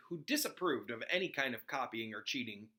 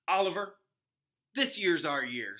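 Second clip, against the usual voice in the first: character voice; 4 semitones higher, 6 dB louder than usual. Acted. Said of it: "said Fred".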